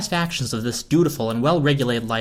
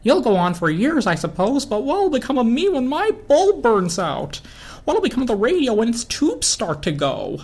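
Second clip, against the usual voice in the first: lightly voiced lamp, rising to fret; a hint of an anxious lamp; lamp voice, worried; 10 semitones higher than usual. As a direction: fret about burning out, pitch climbing.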